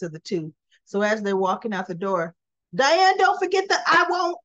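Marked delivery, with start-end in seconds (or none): 2.7-4.4 high, loud playful call after her; teasing call-out. Retold or imitated. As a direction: sing it out to tease her friend. Carly teasing Diane leaving.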